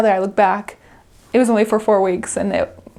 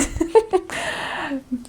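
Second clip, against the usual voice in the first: high laugh; 8 semitones higher, 5 dB quieter than usual. Real, not acted. Laughing at the accent.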